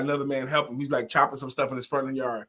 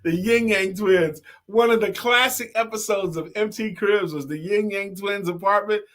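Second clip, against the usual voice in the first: ordinary talk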